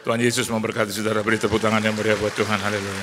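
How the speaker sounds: rally the congregation to praise; preaching call. Real, not acted. Preacher calling the congregation to applaud and pray, rousing. Closing blessing and call to prayer.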